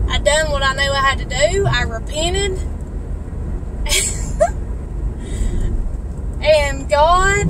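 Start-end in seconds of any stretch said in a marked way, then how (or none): 2.6-6.3 a soft, high, airless laugh; breathy laugh. Real, not acted. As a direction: laugh out gently, moved by joy. Overcome while sharing her testimony.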